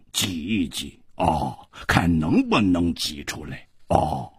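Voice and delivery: quiet villainous mockery; sinister sneer. A narrator's reading. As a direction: taunt softly and deep, drawing words out. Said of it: "狞笑着说".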